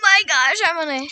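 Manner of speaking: loud, high exasperated cry; frustrated outburst. Real, not acted. Girl failing a parkour stage, loud exasperated cry. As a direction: burst out in frustration.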